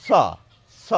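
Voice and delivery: স as a sharp dental s hiss, tongue behind teeth; teacher demonstrates the dental স sound. Shown as a demonstration. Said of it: "দন্ত স এর উচ্চারণ হচ্ছে কীরকম".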